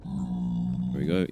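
hushed and slow. Real, not acted. Gamer edging along a ledge, hushed and slow. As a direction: breathe it out low and slow. Careful slow move in game.

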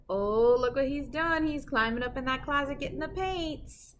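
usual speaking voice

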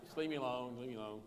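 low, hushed mock brush-off; soft and low, withdrawing from the argument; real quiet. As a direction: imitate a wife fighting very quietly. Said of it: "She's real quiet".

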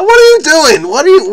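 high, loud put-on indignation; mock outrage. Scolding himself after the Mario bit, high mock indignation. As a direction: play scandalised at your own silliness.

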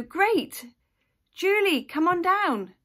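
high, loud, rousing summons; calling out. Retold or imitated. Dentist to a patient in the waiting room, calling out. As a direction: project it across the waiting room. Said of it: "you call out a name".

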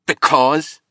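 loud, high burst on one word; a roar that breaks off at once; sudden roar. A narrator's reading. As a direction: explode with the word. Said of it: "Mallory roared suddenly".

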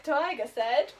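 plain reading voice

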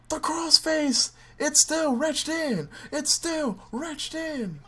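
high, slow wrestling commentator hype; announcer call. Acted. Player mimicking a wrestling commentator, hyped. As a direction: call the hold like a ringside announcer.

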